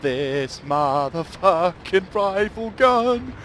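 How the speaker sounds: loud, slow, high gloating about the gun; excited gushing. Real, not acted. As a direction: savour the kill. Thrilled by his gun.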